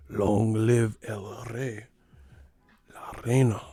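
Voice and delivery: slow, grand Spanish movie line; slow dramatic dub. Retold or imitated. Mocking the Lion King Spanish dub, slow and grand. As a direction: stretch it like a regal pronouncement.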